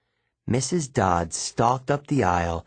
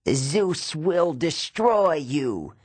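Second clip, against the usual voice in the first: very slow, higher monster threat; menacing fury; 6 semitones higher, much slower than usual. A narrator's reading. Fallen Fury vowing revenge on Percy, slow menace. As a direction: hiss out a vow of doom. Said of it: "she promised".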